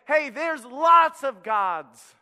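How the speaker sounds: calling out the lie slowly and loudly; world screaming out. Retold or imitated. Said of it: "the world's gonna scream out and say".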